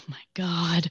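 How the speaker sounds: breathless embarrassed whisper. Real, not acted. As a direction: whisper it to yourself. Embarrassed before telling story.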